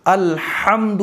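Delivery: careful recitation of الحمد with a clear heavy ha; qirat style. Shown as a demonstration. Teacher showing how to voice الحمد. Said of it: "قرات والے انداز میں".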